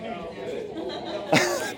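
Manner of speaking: airy high burst of real laughter; high unvoiced giggle, real and unplanned; breathless laugh. Real, not acted. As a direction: laugh helplessly at the prank. Preacher laughs at kids' prank.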